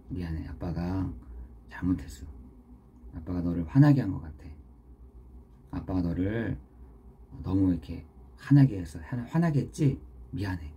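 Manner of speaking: low, gentle fatherly apology; tender apology. Retold or imitated. Father apologizing to his child after angering him, tender re-enactment. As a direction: say sorry softly, as if holding a child.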